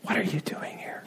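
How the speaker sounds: soft, barely audible whisper; whispered question. Acted. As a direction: repeat it as God's hushed whisper. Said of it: "all you can whisper to me".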